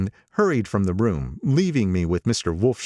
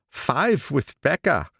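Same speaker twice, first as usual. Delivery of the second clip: put-on higher, nostalgic old man; old gangster voice; 8 semitones higher, slower than usual. Old gangster speaking to the narrator over lunch, higher voice. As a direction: pitch him up as the old racketeer. Wolfsheim corrects.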